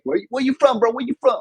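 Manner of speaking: high, loud schoolkid challenge; imitated kids demanding an answer; pestering East Side kids. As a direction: press them like a suspicious neighborhood kid. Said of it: "the kids were just like".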